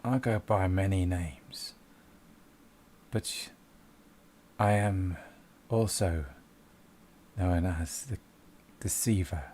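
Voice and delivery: low, slow, drawn-out menace; sinister voice. Acted. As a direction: announce yourself from the shadows. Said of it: "it says".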